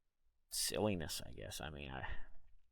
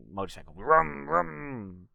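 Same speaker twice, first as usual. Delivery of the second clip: motorcycle noise; 6 semitones higher, 7 dB louder, slower than usual. Shown as a demonstration. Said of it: "like, a motorcycle".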